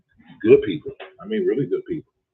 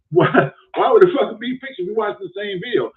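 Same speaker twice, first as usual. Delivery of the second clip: high, loud laughing question; laughing disbelief; 12 semitones higher, 4 dB louder than usual. Real, not acted. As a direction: laugh through the why in disbelief. Laughing at caller's logic.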